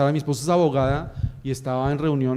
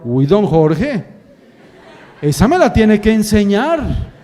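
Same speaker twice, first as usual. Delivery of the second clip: amazed guard; 3 semitones higher, 6 dB louder, slower than usual. Retold or imitated. Amazed guard praises the door trick.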